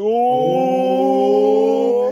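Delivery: high, loud teasing "Oh"; a long, high, loud schoolyard "Oh"; the crowd's teasing ooh at a kid in trouble; mock ooh. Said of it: "when somebody gets called to the principal's office".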